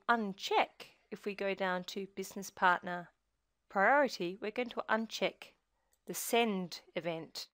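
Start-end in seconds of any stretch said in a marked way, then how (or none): none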